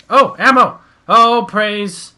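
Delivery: delighted loud cry; excited. Real, not acted. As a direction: shout with relief at finding ammo. Finds ammo, excited shout.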